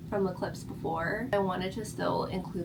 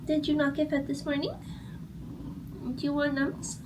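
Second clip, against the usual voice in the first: high sing-song pet voice; 8 semitones higher than usual. Real, not acted. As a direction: coo at the pet, climbing higher. Baby talk to pet.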